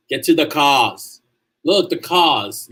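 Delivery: slow, drawn-out Boston "cahs"; playing with a slow Boston accent. Shown as a demonstration. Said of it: "a lot from Boston".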